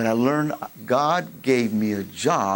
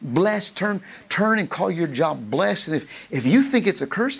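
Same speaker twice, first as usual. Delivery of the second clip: ordinary talk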